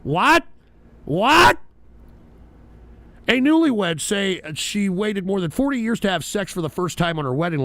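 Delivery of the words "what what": rising, louder disbelieving "what"; incredulous. Acted. Host reacting to an absurd story, incredulous. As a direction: escalate the disbelief, pitch shooting up.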